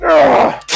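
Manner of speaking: strained grunt mid-lift; effort grunt. Real, not acted. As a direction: push out a high grunt. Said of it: "Sorry for the grunting sounds".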